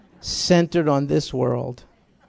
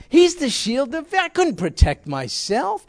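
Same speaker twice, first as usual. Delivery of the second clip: fervent high preaching as David; 9 semitones higher than usual. Acted. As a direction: cry out that the strength is not yours.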